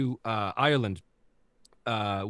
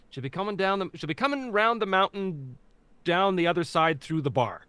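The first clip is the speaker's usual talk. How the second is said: Newfie accent phrase, slightly higher; 6 semitones higher than usual. Shown as a demonstration. Teaching castmates the warm-up line, in a Newfie accent. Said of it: "the phrase that I gave my cast to say in order to get them in the accent".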